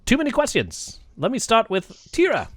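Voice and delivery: high, lilting creature voice; halting high kobold voice; flustered kobold. Acted. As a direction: pitch it up like an overwhelmed little kobold. Kobold answers the players.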